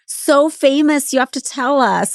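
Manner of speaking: teasing, stretch it out high then ease down; joking jab. Retold or imitated. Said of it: "I would always joke during commentary".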